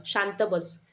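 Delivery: ordinary delivery, nothing marked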